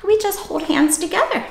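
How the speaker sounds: ordinary talk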